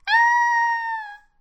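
very high, sustained squeal; long, piercing storybook shriek; loud piglet scream. A narrator's reading. Piglet's house is blown down, screaming high. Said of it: "Het biggetje gilde luid".

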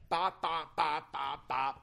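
soft popping gunfire; mock gunshots. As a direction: tap out the shots lightly. Imitating gunshots.

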